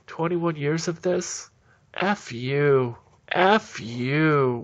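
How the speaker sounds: loud, exasperated outburst; fed-up mock shout. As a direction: throw up your hands and yell it at them. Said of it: "throw up their hands and go".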